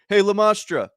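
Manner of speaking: loud hey across the room; calling out. Retold or imitated. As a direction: call his name to get attention. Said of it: "I've never been".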